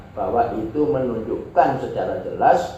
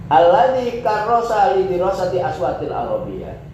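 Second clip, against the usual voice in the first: higher, louder start to the Arabic quote; Arabic text reading; 6 semitones higher, 4 dB louder than usual. Acted. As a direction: recite the Arabic phrase formally. Reads Arabic text aloud.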